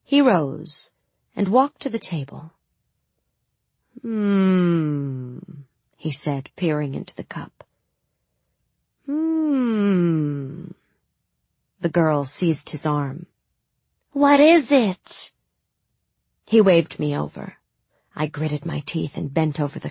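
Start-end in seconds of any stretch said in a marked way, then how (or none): 4.0-5.4 mock pondering hmm. Mal pretends to read cup. 9.1-10.7 mock pondering hmm. Mal pretends to read cup. 14.1-15.3 high, loud, slow worried question; anxious girl. Acted.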